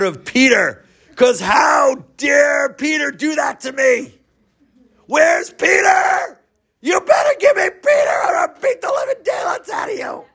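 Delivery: a big angry man bellowing, pitch climbing to a near-cry. Acted. Big bar brute demanding Peter, furious shout. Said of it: "angry, muscular man and says".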